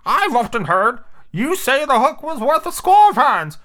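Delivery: high-pitched pirate underling; Smee. Acted. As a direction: chime in high and drawn-out. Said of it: "said Smee".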